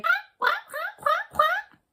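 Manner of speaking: very high squeaky quack, quack; quacking duck. Pretend. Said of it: "I imagine it sounds like".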